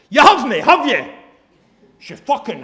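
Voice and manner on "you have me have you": high, loud mock Scottish woman on the phone. Mimicking a loud woman yelling to her friend on the phone. Woman on train voiced loudly.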